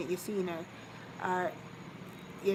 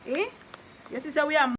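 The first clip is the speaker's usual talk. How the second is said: incredulous mock; 7 semitones higher, 5 dB louder, faster than usual. Mocking a commenter's words.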